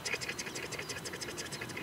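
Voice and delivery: soft unvoiced tongue ticks, rapid; whispered tick chatter. Shown as a demonstration. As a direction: tick it out under your breath like feed chatter. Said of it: "and all I'm doing".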